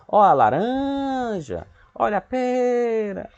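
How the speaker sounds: high, sung-out market cry with long stressed vowels; fruit seller's call. Shown as a demonstration. Teacher imitating a vendor for pupils, drawn-out selling chant. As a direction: hawk fruit, stretching "laranja" and "pera". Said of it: "a gente tentar vender".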